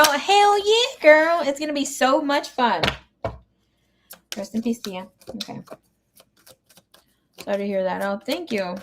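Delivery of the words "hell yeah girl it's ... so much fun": high, bright hell yeah; excited cheer. Real, not acted. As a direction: hype up tomorrow's readings. Excited about love readings.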